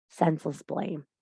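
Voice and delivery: ordinary talk, nothing marked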